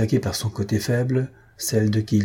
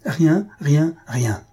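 raised voice, rien repeated firmly; crying out; 4 semitones higher, much slower than usual. A narrator's reading. Aunt refusing to hear her nephew, crying out. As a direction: shut him down with each rien. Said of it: "cria mademoiselle Piedvache".

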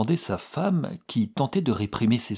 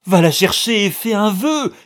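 high, very loud excited shout; excited cry; 13 semitones higher, 12 dB louder than usual. A narrator's reading. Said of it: "cria la vieille femme". (cri exalté, aigu et très fort)

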